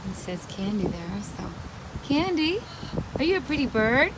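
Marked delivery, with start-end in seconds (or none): 2.0-4.1 high, rising coo at the bird; pet talk. Real, not acted. Talking to the parrot Candy, high cooing pet voice. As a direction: sweet-talk the parrot, pitched up.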